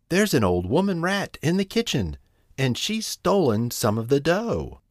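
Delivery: high-pitched kitten reporting trouble; mildly frightened. A narrator's reading. Moppet reports a rat to mother, mildly frightened. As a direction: tell mother with a touch of alarm. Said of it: "be in a terrible fright".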